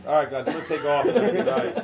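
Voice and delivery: loud clown host signing off, voice climbing; hasty MC goodbye over laughter; Upchuck. Retold or imitated. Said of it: "hosted by a clown named Upchuck".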